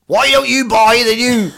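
hammed-up Northern Irish hard sell through a laugh; threatening Northern Irish sell. Joking about Northern Irish car ads, laughing mock Northern Irish threat. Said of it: "They don't want".

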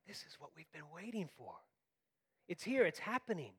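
hushed awe, lifting as it arrives; awe and wonder. Acted. Matthew telling of the long-awaited arrival, in hushed awe. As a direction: begin very softly, then let wonder raise the pitch. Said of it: "he tells a story with awe".

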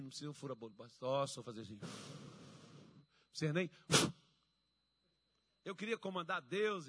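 normal talking voice

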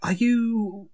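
high, fussy accountant voice; flustered accountant. Acted. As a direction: stammer politely as the accountant.

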